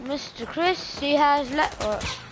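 normal talking voice

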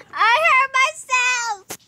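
high, loud, slow mock crying; tearful wail. Hurt child tells the nurse what happened, wailing. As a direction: bawl it out like a little kid.

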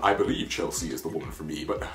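mock his hesitant but-there's-always voice, cracking up; mocking Kwame. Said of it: "There's always".

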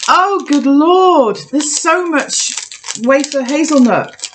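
high, slow, stunned exclaim; drawn-out disbelief; overwhelmed. Real, not acted. Overwhelmed by the hazelnut taste.

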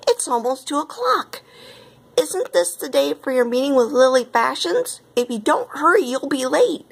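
high-pitched, urgent fretting; alarmed exclamation. A narrator's reading. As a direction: fret aloud that time is running out. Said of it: "exclaimed Missus Appleberry".

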